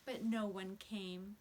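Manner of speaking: ordinary delivery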